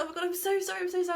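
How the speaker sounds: flustered, rushed sorry in a high voice; flustered apology. Retold or imitated. Friend apologising to Ella after mentioning him, flustered and high.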